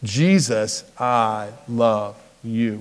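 slow, soft Jesus I love you, drawn out; sung out together with the kids; slow group repeat. Shown as a demonstration. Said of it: "Can you say that with me".

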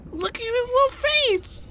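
very high gushing coo; overwhelmed by cuteness. Real, not acted. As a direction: gush over the tiny face. Gushing over creature.

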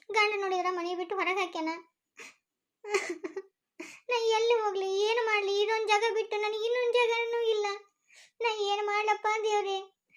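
high, crying plea to God; tearful lament. Acted. Abandoned wife lamenting to God before her child, crying. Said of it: "ಅವ್ವ, ನೀ ಅಳ್ಬೇಡ".

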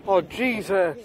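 loud startled oh Jesus. Real, not acted. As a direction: jump at the noise.

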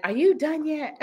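high-pitched mock husband question; imitating husband. Wife imitating her husband texting her, high mock questioning.

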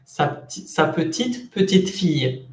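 slow rising "sa petite", then falling "petite fille"; tone contrast. Shown as a demonstration. Teacher demonstrating little granddaughter, first "petite" rising, "petite fille" falling. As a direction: pause between the two "petite", second joined to "fille". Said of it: "del tono".

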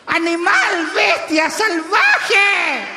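high, drawn-out outraged shriek; mock screaming. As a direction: hurl each insult as a shrieking posh lady. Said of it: "Gritaba, weón". (chillido indignado de señora pituca)

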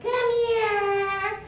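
high, drawn-out pleading call; ineffective sweet call. Shown as a demonstration. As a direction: coo it up high like calling a puppy. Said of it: "That's not gonna work".

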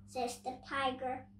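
usual speaking voice

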